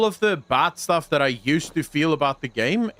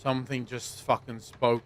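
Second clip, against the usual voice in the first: hushed; 3 semitones lower, 8 dB quieter than usual. Real, not acted. Startled by a sound in game.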